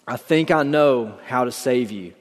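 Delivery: ordinary delivery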